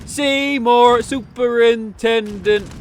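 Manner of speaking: hammed-up caught-out surprise; jumpy, high mock alarm; startled greeting. Skinner caught at the window.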